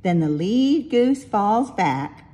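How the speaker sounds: plain reading voice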